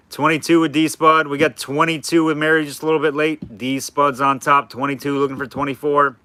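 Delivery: light auctioneer bid calling; sing-song bid calling, lightly done. Shown as a demonstration. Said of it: "I'm doing the auctioneer voice".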